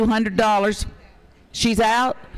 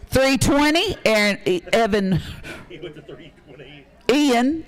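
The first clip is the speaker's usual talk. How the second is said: excited bid calling. Real, not acted. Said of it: "Y'all got me so excited".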